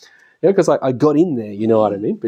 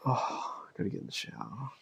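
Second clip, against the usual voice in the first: much softer, dragging weary mumble; mock weary; 4 semitones lower, 20 dB quieter, faster than usual. Husband walking in the door faking exhaustion, much softer weary mumble. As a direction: drag the words like dragging your feet.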